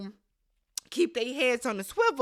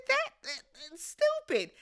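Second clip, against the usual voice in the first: exasperated; 6 semitones higher than usual. Retold or imitated. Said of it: "I said".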